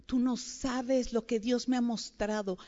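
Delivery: ordinary talk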